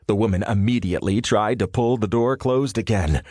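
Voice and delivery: ordinary delivery, nothing marked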